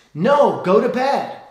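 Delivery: stern dad's retort. Retold or imitated. Dad shouts back at thirsty son, sharp and loud. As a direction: cut the kid off firmly.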